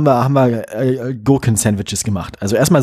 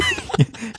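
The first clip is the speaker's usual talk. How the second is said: high squealing laugh burst; hysterical laugh; 6 dB quieter than usual. Real, not acted. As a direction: break into shrieking laughter. Laughing after fumbling words.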